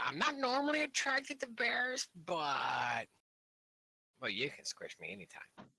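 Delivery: usual speaking voice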